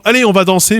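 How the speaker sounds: a host calling everyone onto the floor; inviting people to dance. Retold or imitated. Inviting the guests at a ball to come dance. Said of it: "vous dites aux gens".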